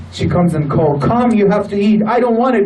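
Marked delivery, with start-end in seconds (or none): 1.0-2.0 caring mother. Retold or imitated. A very passionate and kind mother. 2.1-2.6 put-on whiny child. Child refusing food, voiced by preacher.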